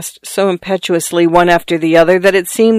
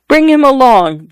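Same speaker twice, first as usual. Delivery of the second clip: higher, louder curt order; said curtly; 8 semitones higher, 4 dB louder than usual. A narrator's reading. Married lady ordering the sailor, curtly. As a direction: cut it short, a command. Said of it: "the married lady said curtly".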